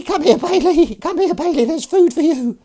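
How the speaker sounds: high, loud falsetto summons; calling a cat. Pretend. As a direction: call a pet to its dinner. Calls the cat to food.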